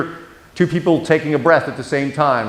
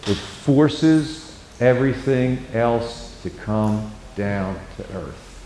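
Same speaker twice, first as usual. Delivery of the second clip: very slow and much lower, sinking softer; calm slow tone; 4 semitones lower, 6 dB quieter, much slower than usual. Shown as a demonstration. Counselor showing trainees the calming voice, slow and low. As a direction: let the voice settle right down to earth. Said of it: "calm, slow tone".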